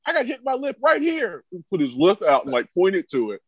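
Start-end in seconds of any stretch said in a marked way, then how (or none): 0.1-1.4 high, whiny mock complaint; mocking Mike Brown. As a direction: pout and whine about the lip. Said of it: "gets in the middle of the two and goes".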